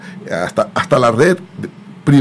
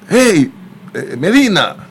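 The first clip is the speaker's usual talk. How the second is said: raised, higher hail to someone; calling out; 6 semitones higher, slower than usual. Retold or imitated. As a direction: imitate someone calling a man over. Someone calls out his surname. (imitando a alguien que llama en voz alta)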